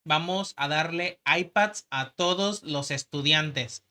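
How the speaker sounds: slow, plodding mock politician speech; imitating Josefina Vázquez Mota. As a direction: mimic a candidate's promise, word by laboured word. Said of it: "Y así hablaba ella".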